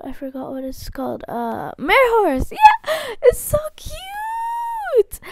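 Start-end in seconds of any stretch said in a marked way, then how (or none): 2.5-5.2 very high, slow squeal. Real, not acted. YouTuber squealing at a cute pet, very high and slow. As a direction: squeal it out over something cute.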